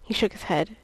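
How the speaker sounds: ordinary delivery, nothing marked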